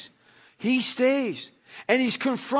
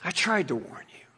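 Jesus gently comforting Peter; tender compassion; 3 semitones lower, faster than usual. Pretend. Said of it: "look at him with love and compassion".

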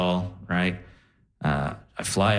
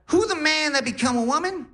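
high, put-on Def Jam comedian voice; crazy Cat Williams accent. Grandma on morphine greets grandson in a high comic accent. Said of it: "talking in a crazy accent".

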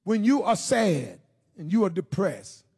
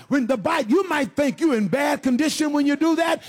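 ordinary talk, nothing marked

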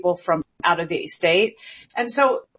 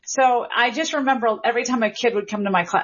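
ordinary talk, nothing marked

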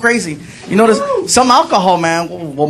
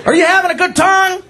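high-pitched mock bartender voice; 10 semitones higher than usual. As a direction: challenge a rowdy customer in a high put-on voice. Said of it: "Bartender starts checking me".

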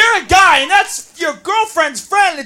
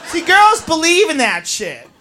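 normal talking voice

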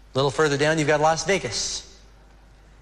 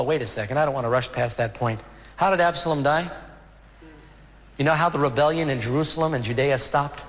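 ordinary talk

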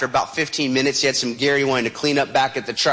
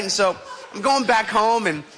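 ordinary talk

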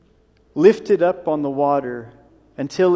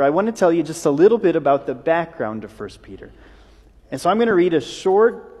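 ordinary talk

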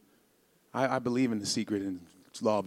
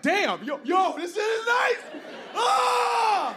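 high, loud, slow delighted whoop ending in a yell; thrilled passenger; 3 dB louder, much slower than usual. Retold or imitated. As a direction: gush over the legroom then let out a happy yell. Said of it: "You're like".

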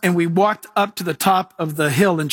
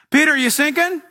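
mock taunt; 7 semitones higher than usual. Mocking a harsh judging God.

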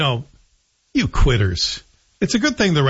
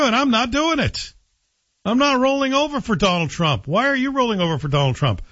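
defiant; 9 semitones higher than usual. Real, not acted. Defiant stand against Trump.

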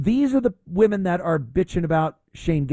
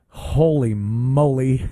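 usual speaking voice

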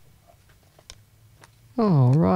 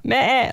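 cat call; 6 semitones higher than usual. Retold or imitated. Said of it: "like that to the cat".

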